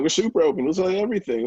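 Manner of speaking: high, loud declaration of openness; eager retold answer. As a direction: throw it out wide open. Retelling their own eager answer.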